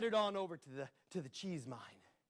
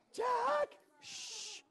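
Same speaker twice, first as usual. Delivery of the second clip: very high urgent hush; anxious hush. Acted. Princess begging Jack to stop, high and anxious. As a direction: squeak a warning.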